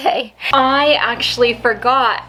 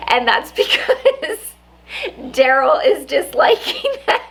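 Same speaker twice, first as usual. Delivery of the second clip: laughing; 5 semitones higher than usual. Real, not acted. Laughing about brother disliking videos.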